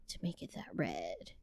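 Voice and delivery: breathy whispered question; whispering. Real, not acted. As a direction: whisper it as if telling a secret. Wonders about Cheetos dye.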